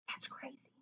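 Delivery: hushed aside to the listener; whisper. Real, not acted. Reader marvelling at the story, whispering. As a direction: breathe it like a secret.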